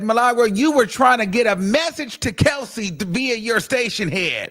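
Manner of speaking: high-pitched mock accusation, slowing at the end; accusing lawyer. Lawyer accusing the witness of messaging someone, high-pitched retold accusation. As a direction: imitate a lawyer pressing an accusation. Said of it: "He even told her that".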